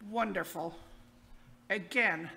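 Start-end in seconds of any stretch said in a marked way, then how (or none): 0.1-0.8 dry, lower sarcastic wonderful. Pretend. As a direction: say it like nothing could be worse. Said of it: "said sarcastically in your head".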